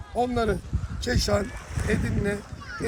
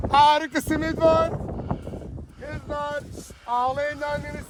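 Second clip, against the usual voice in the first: street vendor call; 5 semitones higher than usual. Acted. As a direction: pitch your simit to the whole street. Street simit seller hawking.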